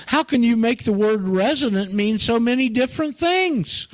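high, insistent storybook questioner; Alice. A narrator's reading. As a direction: read it high all through, as a puzzled challenge. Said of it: "said Alice".

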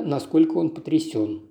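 usual speaking voice

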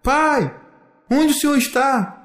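high, searching call for father; child's high-pitched holler. A narrator's reading. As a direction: call out for your lost dad, vowels held. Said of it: "Então ele grita".

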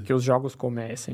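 lightly voiced horror villain; low, ominous game-master line; horror movie voice. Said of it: "você só ouve a voz falando".

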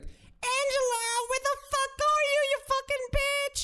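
mock her shrieking across the mall, way up high; mocking shrill scream. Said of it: "she was screaming by, for her name".

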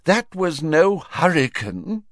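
slow, high-pitched wretched reply; woeful correction; miserable. A narrator's reading. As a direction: plead it miserably, drawn out. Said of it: "said Fudge miserably".

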